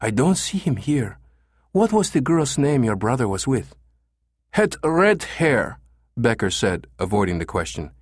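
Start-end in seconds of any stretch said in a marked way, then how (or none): 0.0-3.8 higher female Spanish receptionist; pleasant female voice. A narrator's reading. Receptionist questioning caller about girl, pleasant female voice. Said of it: "a pleasant female voice". 4.5-5.8 high, slow German tourist; thick German accent. A narrator's reading. Caller avoiding the question, thick German accent. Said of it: "with a thick German accent".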